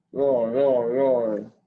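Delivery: loud playful dinosaur roar. Pretend. Child reading a dinosaur book aloud, loud playful roar.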